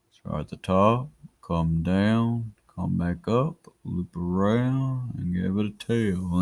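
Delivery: drawn-out words, slow and measured. Shown as a demonstration. Teacher guiding the pen through each stroke, slow-mo. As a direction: take each step slowly, stretching the vowels. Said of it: "I'll do slow-mo".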